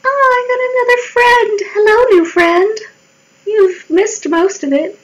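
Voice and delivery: high-pitched squealed welcome; excited greeting. Real, not acted. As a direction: greet a new friend in a high, delighted voice. Greeting a newly arrived friend.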